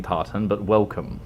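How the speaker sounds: ordinary delivery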